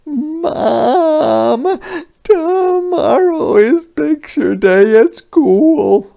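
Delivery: high, loud crying wail; wailing child. Acted. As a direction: wail it like an upset little girl. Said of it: "wailed, or cried loudly".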